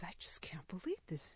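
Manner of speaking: soft, unvoiced disbelief; a nurse whispering her amazement; amazed whisper. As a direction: whisper it in astonishment.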